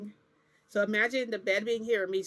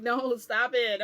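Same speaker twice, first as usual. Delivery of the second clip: higher, louder playful protest; mock protest; 4 semitones higher, 8 dB louder, slower than usual. Telling him to stop chasing her, mock loud protest. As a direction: protest loudly, half laughing. Retold protest during the chase.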